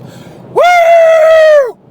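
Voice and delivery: high, loud "woo" whoop; imitated locker-room scream. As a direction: whoop like a rowdy teenage swimmer bursting in. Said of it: "like that".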